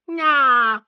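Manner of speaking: put-on high whiny child's nah; whining child. A narrator's reading. Sissy refusing the question, whining. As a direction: whine it like a sulky girl. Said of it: "whined Sissy".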